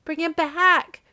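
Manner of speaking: high, loud frantic demand; desperate cry. A narrator's reading. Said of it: "cried the old woman".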